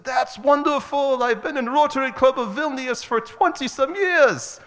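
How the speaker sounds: very high, loud mock Lithuanian accent, delighted; thick Lithuanian accent. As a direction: imitate an overjoyed Lithuanian minister, stretching the opening words. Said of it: "in this thick Lithuanian accent".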